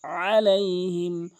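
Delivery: আলাইহিম slow, আইন from the throat; correct Alaihim. Shown as a demonstration. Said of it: "বলা যাবে না". (শুদ্ধ, আইন দিয়ে)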